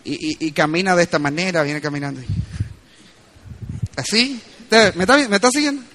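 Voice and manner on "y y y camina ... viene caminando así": ordinary talk, nothing marked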